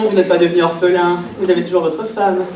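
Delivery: ordinary talk, nothing marked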